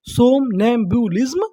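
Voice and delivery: सोमनैम्बुलिज्म slowed into parts, rising at the end; syllable by syllable. Shown as a demonstration. Teacher prompting learners for the English word, slow and rising. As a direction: break सोमनैम्बुलिज्म slowly into its syllables. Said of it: "English में क्या बोलेंगे".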